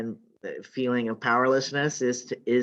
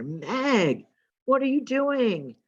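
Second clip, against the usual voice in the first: higher, calling across the street; 11 semitones higher, slower than usual. Retold or imitated. Friend spotting Meg on the next block, higher calling out. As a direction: call out to a surprised friend, voice up high.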